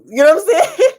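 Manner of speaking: high, loud, laughing sass; sassy. Real, not acted. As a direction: throw a little attitude with a laugh. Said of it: "got a little attitude on that one".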